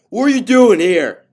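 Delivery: furious shout. A narrator's reading. Father catching a young man at his house, furious shout. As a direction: bellow at the trespasser. Angry father confronts intruder.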